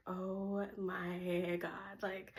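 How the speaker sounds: hushed dawning realization; quiet realization. Retold or imitated. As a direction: let it dawn on you slowly. Sudden realization moment.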